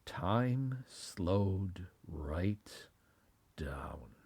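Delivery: drawn-out hushed suspense; slow whisper. A narrator's reading. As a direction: stretch every word in a whisper.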